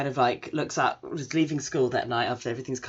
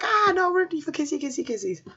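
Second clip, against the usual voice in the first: squealing kissy; 13 semitones higher than usual. Retold or imitated. Fangirl speaker squealing over the kiss, very high. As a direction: squeal in excitement for kisses. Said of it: "it's like".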